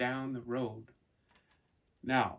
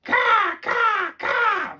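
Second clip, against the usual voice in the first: high, loud cawing cackle; laughing raven caw; 14 dB louder, much slower than usual. A narrator's reading. Raven laughing at the foolish humans, loud high caws. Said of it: "the ravens laughed".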